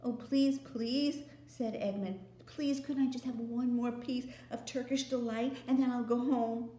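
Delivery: put-on greedy boy begging the queen. Edmund pleading.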